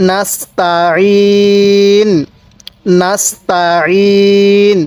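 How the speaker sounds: নাস্তাইন with thin s and throat-pressed ain; careful tajweed demo. Shown as a demonstration. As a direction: slowly stretch the final i of নাস্তাইন. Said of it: "সিনটা চিকন করে আইনটা উচ্চারণের সময় গলার মধ্যে চাপ দিয়ে পড়লে".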